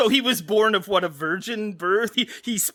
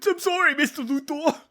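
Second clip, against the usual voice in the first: higher, timid henchman apology; cowering henchman; 8 semitones higher than usual. Pretend. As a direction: grovel to the boss.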